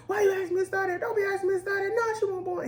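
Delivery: put-on high squeaky kids teasing; kids' voices. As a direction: pitch it up like schoolkids. Kids voiced in retold story.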